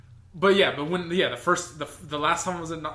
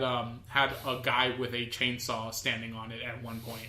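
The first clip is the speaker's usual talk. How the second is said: usual speaking voice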